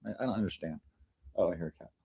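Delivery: normal talking voice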